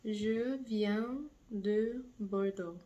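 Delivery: Je viens de Bordeaux, said slowly in French; slow French model phrase. Shown as a demonstration. Teacher modeling a sentence slowly for students. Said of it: "I'm gonna use the example of Bordeaux".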